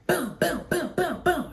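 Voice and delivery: soft, high booms falling in pitch; imitated punch sounds. Storyteller acting out swinging on the man, punch sound effects. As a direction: fire off high little punch noises.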